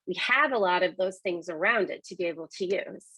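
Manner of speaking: ordinary delivery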